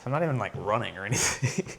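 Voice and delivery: winded, a little breathless and soft; breathless aside to viewers; out of breath. Real, not acted. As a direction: talk through short breaths. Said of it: "I'm getting out of breath".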